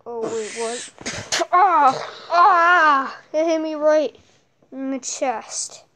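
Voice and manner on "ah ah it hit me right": loud hurt cries, high; pained yelling. Acted. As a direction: yell out from the hit. Hit in the chest.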